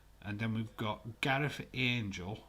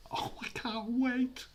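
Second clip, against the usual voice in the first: much higher, a squeaky thrilled voice; excited squeal; 4 dB louder than usual. Real, not acted. Thrilled about The Rock coming.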